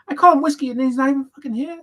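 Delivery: cheery teacher. Retold or imitated. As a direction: call the roll with a big grin. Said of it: "He's like".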